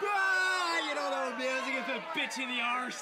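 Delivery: loud shouted mock English accent. Judging a bad rendition, shouted English accent. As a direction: bellow it in a hammed-up English accent. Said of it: "English accent".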